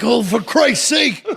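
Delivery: slow, high laughing groan; mock exasperation through a laugh; exasperated laugh. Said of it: "And I'm like".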